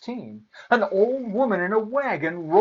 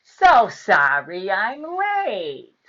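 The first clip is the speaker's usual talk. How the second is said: slow, loud, sugary snarl; wicked fairy's syrupy menace; snarling sweetly; 4 semitones higher, 6 dB louder, much slower than usual. A narrator's reading. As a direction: stretch out a fake-sweet apology. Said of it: "she snarled sweetly".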